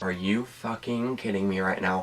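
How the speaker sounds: hushed disbelief. Real, not acted. Disbelief at his wife call.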